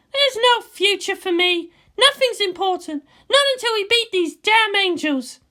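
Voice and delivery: a tense commander on the eve of battle; Misato's voice. Acted. Misato brooding to Ritsuko about tomorrow, high and loud.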